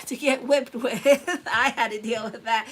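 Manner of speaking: loud, laughing through the words; real laughter breaking into speech. Real, not acted. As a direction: crack up while recalling it. Laughing over childhood whipping memory.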